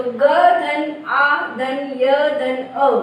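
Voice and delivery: very slow, each letter spelled apart; drawn-out phonics breakdown; slow letter-by-letter spelling. Shown as a demonstration. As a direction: spell it out slowly for children. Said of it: "आ और अ का प्रयोग हुआ है".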